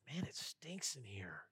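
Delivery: hushed and unvoiced, slow and disgusted; whispered disgust. To old friends in a smoky room, whispered disgust. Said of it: "I looked at them and said".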